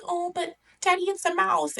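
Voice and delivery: high-pitched put-on daughter voice; pleading little princess. Princess begging her father for the mouse, high mock pleading.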